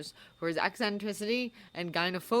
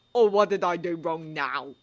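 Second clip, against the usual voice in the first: irritable; 6 dB louder than usual. Acted. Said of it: "asked Ron irritably".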